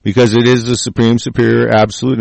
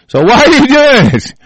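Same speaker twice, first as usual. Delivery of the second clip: incredulous; 5 dB louder than usual. Real, not acted. Mocking Washington's escalation logic.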